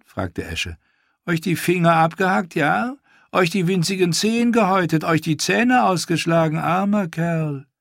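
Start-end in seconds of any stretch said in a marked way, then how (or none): 1.2-7.7 much higher, louder cooing woman's voice; cruel mock pity. Ash taunting Theon with false pity, high cooing. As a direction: coo false sympathy at him.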